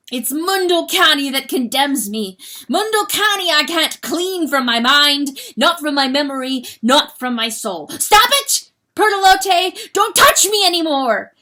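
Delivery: loud high anguished ranting; tormented outburst. Acted. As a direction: push her off, screaming. Rooster tormented, pushing her away.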